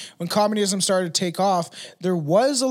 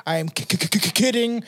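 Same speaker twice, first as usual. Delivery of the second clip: mock stutter; 3 semitones higher than usual. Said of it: "stuttering the word".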